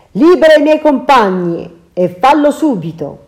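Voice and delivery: loud, stern order; commanding hero. Acted. Ulysses ordering Circe to free his men, loud. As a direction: give the order, no arguing.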